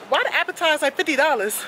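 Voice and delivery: high, quick incredulous question; retold price shock. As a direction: retell your disbelief at the bill. Retelling shock at menu prices.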